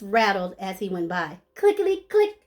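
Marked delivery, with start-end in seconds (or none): 1.5-2.4 high rattling rhythm; clicky sound effect. Acted. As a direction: click it out crisply. Sound word in story.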